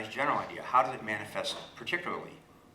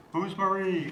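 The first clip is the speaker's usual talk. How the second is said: gods of fiction, laughing; 9 semitones higher, 5 dB louder, slower than usual. Retold or imitated. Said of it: "the gods of fiction say".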